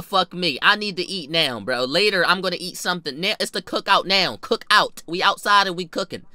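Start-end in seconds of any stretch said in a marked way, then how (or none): none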